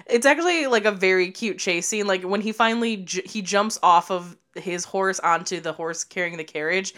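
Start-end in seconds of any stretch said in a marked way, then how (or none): none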